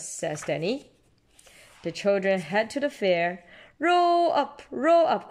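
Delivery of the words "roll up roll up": fairground call. A narrator's reading. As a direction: call the crowd in like a fair barker. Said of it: "cries Miss Rabbit".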